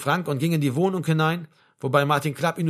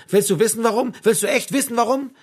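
raised, high, agitated questioning; getting louder; 8 semitones higher, 5 dB louder than usual. A narrator's reading. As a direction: repeat it insistently, climbing in pitch. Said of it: "wiederholte er und wurde lauter dabei".

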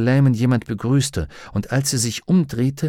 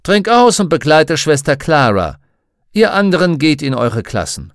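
loud firm order; commanding; 5 semitones higher, 8 dB louder than usual. A narrator's reading. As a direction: give the orders loudly. Doctor gives orders to children.

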